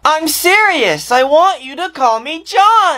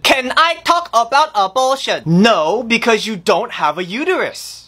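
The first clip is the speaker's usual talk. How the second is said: ordinary delivery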